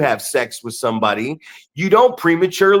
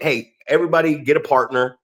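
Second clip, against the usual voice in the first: usual speaking voice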